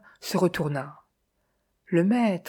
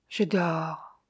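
soft, sleeping answer from far away; fragile, distant voice; 5 dB quieter than usual. A narrator's reading. Clairvoyant answers the master in her sleep, faint and distant. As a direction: let it out softly, as if asleep. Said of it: "Une voix infiniment fragile, semblant venir de très loin".

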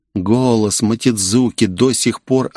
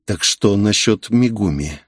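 ordinary delivery

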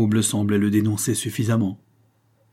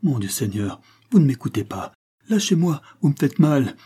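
higher, louder panicked pleading; terrified cry; 5 semitones higher, 3 dB louder than usual. Acted. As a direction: cry out as if grabbed. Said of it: "cris d'épouvante".

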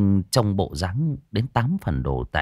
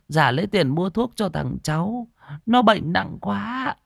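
put-on pleading old woman; 8 semitones higher than usual. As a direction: beg meekly for a few more coins. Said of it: "Bà cụ nài nỉ".

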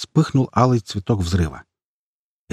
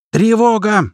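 sudden high alarm shout; loud shout; 11 semitones higher, 5 dB louder, slower than usual. A narrator's reading. Lookout warns the fighters, shouting suddenly. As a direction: cry out the alarm, voice pitched up. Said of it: "неожиданно громко крикнул хомяк".

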